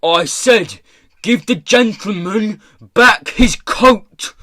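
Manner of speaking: high, slow, very loud order; furious shout. A narrator's reading. Pirate boss shouting at the corpse, furious. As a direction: shout it out, spacing the words. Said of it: "He shouted at the startled-looking corpse".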